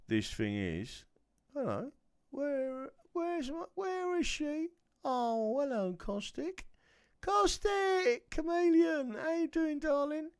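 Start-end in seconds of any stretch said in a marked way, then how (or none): 1.5-9.2 much higher, cooing greeting; squeaky pet voice. Real, not acted. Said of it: "My voice is going funny". 9.2-10.3 squeaky pet voice. Real, not acted. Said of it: "My voice is going funny".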